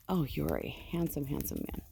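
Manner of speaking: hushed, tender compliment; soft doting praise. Real, not acted. Patting her ram, she softly tells him he's handsome. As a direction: murmur it lovingly to the sheep.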